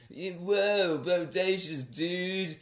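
slow, high surfer drawl; surfer dude voice. Retold or imitated. Imitating Keanu Reeves' dude voice, slow and high. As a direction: stretch it out like a stoner surfer. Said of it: "he's still got very much his".

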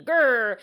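playful mock growl; grr, a teasing growl. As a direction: growl it like an angry brother.

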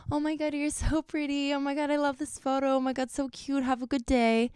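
high-pitched gushing fan compliments; gushing followers. Acted. Followers flattering a photo she posted, gushing. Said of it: "hope for people to be like".

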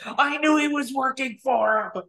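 hammed-up British accent, crowing; bad British accent. Host imitates British colleague gloating about the pick, hammed-up British accent. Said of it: "sorry for totally butchering the British accent".

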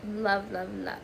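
words pushed out on a burp. Real, not acted. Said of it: "Sorry, I had to burp".

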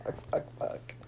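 hissed frustrated whisper under breath; whispered cursing. Real, not acted. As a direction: swear in a whisper. Frustrated at losing the tiebreak.

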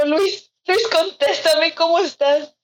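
high, whiny mock plea; retold anxious pleading. Begging Luis to answer the messages, anxious pleading.

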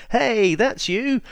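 high, slow and cheeky; a lightly voiced Austin Powers; Austin Powers impression. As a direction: greet everyone like a grinning Austin Powers. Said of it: "he's like".

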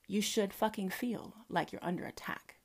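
menacing whisper. Real, not acted.

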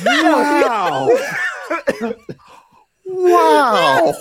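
high laughing shout; laughing wow. Real, not acted. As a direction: burst out laughing in disbelief. Shocked laugh at old photo.

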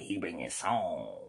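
slow breathy whisper. Acted. Calling Frankie over in a slow whisper. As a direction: whisper it like a secret.

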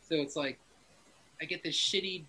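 ordinary talk, nothing marked

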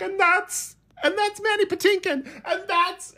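very high, choked-up gushing; tearful gushing. Retold or imitated. Dad pointing out Mandy Patinkin while weeping, very high and teary. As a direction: squeak it out through happy tears. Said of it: "And I was like".

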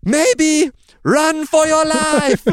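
high, loud, very slow put-on voice; hammed-up clueless beekeeper, dragging the words out; beekeeper Simon. Simon giving up and telling him to flee, high slow hammed voice. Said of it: "He says".

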